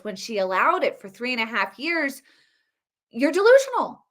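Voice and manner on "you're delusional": pitched up and loud, scoffing; high scornful jab; scornful retort. Real, not acted. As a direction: throw it at them with disbelief. Scolding Harris supporters.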